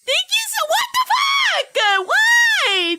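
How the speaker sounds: high, loud startled outburst; stunned shout. Real, not acted. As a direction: blurt it out in real shock. Shocked by sudden gift subs.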